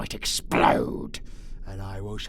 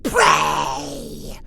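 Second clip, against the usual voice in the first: louder, spitting scorn at weakness; contemptuous shout; 4 semitones higher, 10 dB louder than usual. Acted. Captor dismissing weak prey, scornful shout. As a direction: bark it with disdain.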